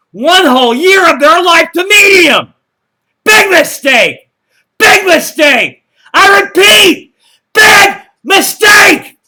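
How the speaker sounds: very loud, high, slow shout; all-caps shouting. Acted. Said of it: "when you type in caps".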